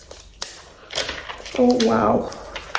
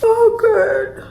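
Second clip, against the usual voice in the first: emotional high cry; 13 semitones higher than usual. Real, not acted. Emotional at the gift.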